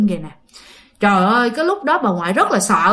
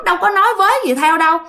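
usual speaking voice

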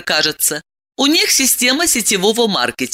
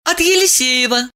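confessing; 7 semitones higher than usual. Acted. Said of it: "призналась Диана".

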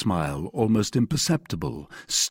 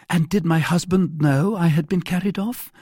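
high, louder female character voice; anxious heroine; 9 semitones higher, 5 dB louder than usual. A narrator's reading. Abducted wife asks her rescuer about her husband, anxious. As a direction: ask it high and concerned.

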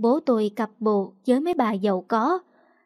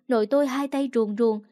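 usual speaking voice

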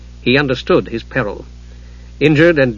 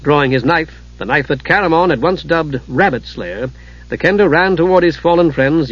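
usual speaking voice

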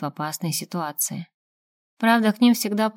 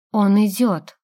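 sudden alarmed warning, louder; 6 dB louder, slower than usual. A narrator's reading. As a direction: blurt it out in alarm. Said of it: "В голосе Кикиморы прозвучала тревога".

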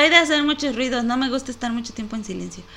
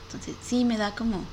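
ordinary talk, nothing marked

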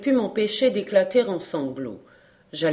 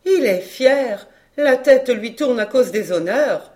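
crying out; 6 semitones higher, 7 dB louder than usual. Acted. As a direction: proclaim the verdict at full voice. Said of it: "cria madame Madeleine". (exclamation criée, haute et forte)

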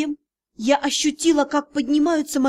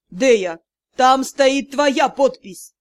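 angry accusation; 5 dB louder, much slower than usual. A narrator's reading. Magistr accusing the heroine of signing, slow and loud. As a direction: spell it out slowly in anger.

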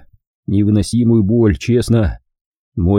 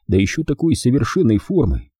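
ordinary delivery, nothing marked

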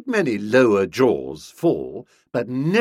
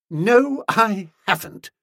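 pointed reply; 10 semitones higher, 3 dB louder than usual. A narrator's reading. Bertie answers before mirthless laugh.

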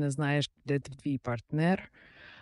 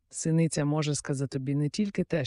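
ordinary delivery, nothing marked

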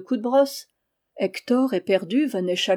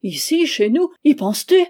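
loud, higher indignant cry; outraged outburst; 6 semitones higher, 8 dB louder than usual. Acted. Husband bursts out at his wife, loud and indignant. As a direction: jump at her with shocked disbelief. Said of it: "Il bondit".

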